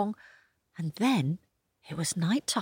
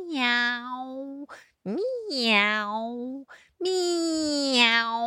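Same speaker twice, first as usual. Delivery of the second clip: higher meowing; cat meow; 6 semitones higher, much slower than usual. A narrator's reading. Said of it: "The cat meowed".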